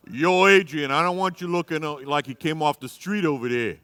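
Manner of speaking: loud Rocky-style accent, hammed up; new accent. Husband telling his wife to look nice, loud Rocky-style accent. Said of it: "I gotta do a new accent".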